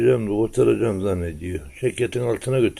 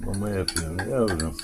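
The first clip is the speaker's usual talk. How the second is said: normal talking voice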